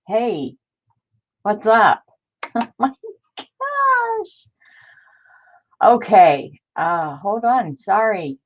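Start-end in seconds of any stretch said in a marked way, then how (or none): 2.6-4.5 surprised gasp. Real, not acted. Reacting to chat news.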